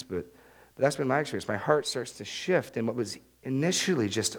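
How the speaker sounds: ordinary talk, nothing marked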